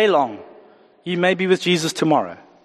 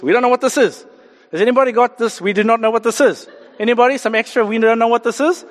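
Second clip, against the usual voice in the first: higher-pitched, mock-bewildered crowd calls; puzzled Israelites calling out; 6 semitones higher than usual. Israelites asking each other about the manna, puzzled calling out. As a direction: call it out high, as if asking the neighbours.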